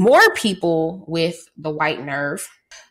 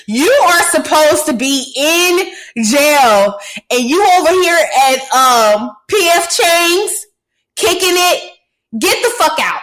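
louder, much higher outraged rant; 8 semitones higher, 6 dB louder than usual. Real, not acted. Host ranting at a convict caught eating out, loud outrage. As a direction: rant at him in disbelief, loud. Outraged at jailed man dining.

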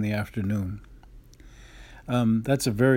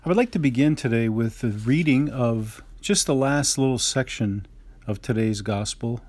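ordinary talk

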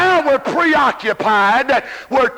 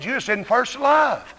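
ordinary delivery

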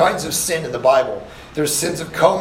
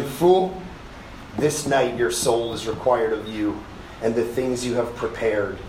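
lower, softer, grave and slow; solemn voice of God; 5 semitones lower, 5 dB quieter than usual. Acted. God answering the rich fool in the parable, low and grave. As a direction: pronounce the sentence quietly and heavily. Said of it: "God's judgment in parable".

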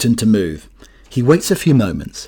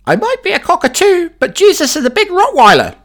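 very high, squawky parrot voice, level and sing-song; cockatoo punchline; 5 dB louder than usual. Acted. Cockatoo warns the burglar about the dog, high and squawky. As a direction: deliver the punchline like a smug cockatoo. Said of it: "The cockatoo looks at the burglar and says".

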